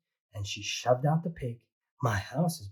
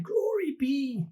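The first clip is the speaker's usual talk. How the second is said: much higher, slow cry of dismay; exclaiming; 14 semitones higher, 4 dB louder, slower than usual. Acted. Old lady exclaiming at the goat's mess, high dismayed cry. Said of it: "The little old lady cried".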